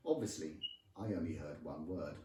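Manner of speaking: ordinary delivery, nothing marked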